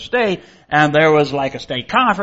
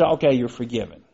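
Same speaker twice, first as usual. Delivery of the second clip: ordinary talk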